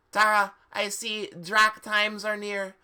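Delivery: high, loud hammed-up seer voice; hammed-up fortune-teller; badly said prophecy. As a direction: do a deliberately bad fortune-teller line. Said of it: "she said badly".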